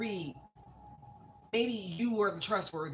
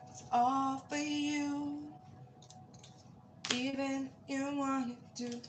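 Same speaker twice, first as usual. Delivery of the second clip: It's all for you, much higher and slowly stretched out; high drawn-out; 9 semitones higher, slower than usual. Pretend. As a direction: croon "all" up high, lingering on it. Repeats the line, second take sung-out.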